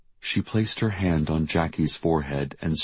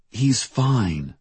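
higher-pitched reassurance with a falling end; insistent reassurance; 7 semitones higher than usual. A narrator's reading. Diane reassuring Trin about the missing boy, insistent. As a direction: reassure firmly, pitch lifted. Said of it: "Diane said to Trin".

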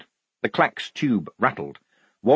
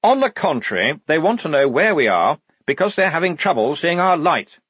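higher, insistent officer; assertive lieutenant; 7 semitones higher, 5 dB louder than usual. Acted. Lieutenant correcting his sergeant about the message, assertive. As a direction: contradict with confident authority.

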